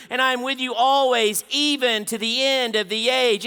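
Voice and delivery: loud, high preached reading; booming pulpit proclamation; preaching scripture. Acted. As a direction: proclaim it to the crowd. Reads scripture at full force.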